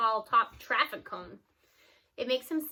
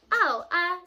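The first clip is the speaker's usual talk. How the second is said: caught-out hesitation, higher and louder; nervous boy; 6 semitones higher, 8 dB louder than usual. A narrator's reading. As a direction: stammer like a kid caught lying. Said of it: "Scoob gulps".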